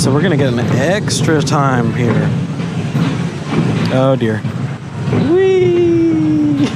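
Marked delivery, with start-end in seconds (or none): 5.3-6.7 excited squeal. Real, not acted. Riding a roller coaster drop.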